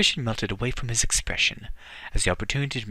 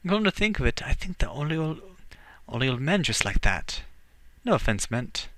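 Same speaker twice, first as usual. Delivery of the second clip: put-on light Scottish lilt, teasing young woman; a hint of Scottish burr, cheeky; Scottish accent. Siobhan teases the young scout, light Scottish accent. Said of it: "Scottish accents sometimes".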